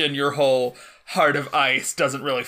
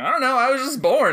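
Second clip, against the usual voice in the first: high, loud little lamb; newborn lamb voice; 11 semitones higher, 5 dB louder, faster than usual. Acted. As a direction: chirp it like a lamb just born. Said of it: "She asks a little lamb".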